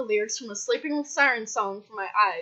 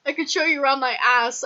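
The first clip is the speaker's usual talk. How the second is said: ordinary delivery, nothing marked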